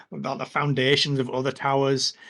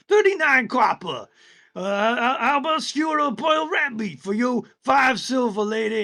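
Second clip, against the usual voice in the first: goblin hawker; 9 semitones higher than usual. Acted. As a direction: sell rubbish like a pushy goblin. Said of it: "and he's going".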